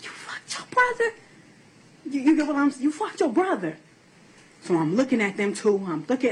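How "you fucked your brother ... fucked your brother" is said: high-pitched retold accusation; retold outburst. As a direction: replay the shock of accusing him. Mother retells confronting son.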